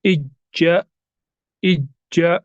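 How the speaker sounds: Itza with the ज्ज pressed hard; emphasized doubled letter. Shown as a demonstration. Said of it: "emphasise करेंगे".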